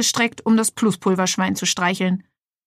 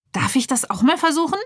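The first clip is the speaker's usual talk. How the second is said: put-on higher eager kid voice; eager boy; 6 semitones higher than usual. Lenny eagerly asks the worker if he may try. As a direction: ask keenly like a curious boy.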